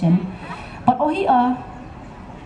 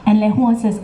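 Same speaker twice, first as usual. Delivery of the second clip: ordinary delivery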